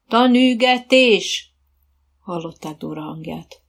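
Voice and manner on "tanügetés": slow, drawn-out riding command, loud and higher; called-out command. A narrator's reading. Coach to riders on the track, loud drawn-out call. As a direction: call it out long across the arena.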